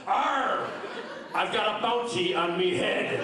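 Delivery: hammed-up "Arr" into a drawn-out punchline; slow pirate delivery. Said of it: "And the pirate says".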